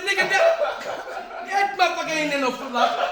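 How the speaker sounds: high, loud, cracking with laughter; laughing retold yell. Telling the thief off, loud and laughing.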